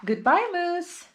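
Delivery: put-on high child's farewell; a child's goodbye; girl's goodbye. A narrator's reading. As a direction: say goodbye gently but high. Girl says goodbye to dog.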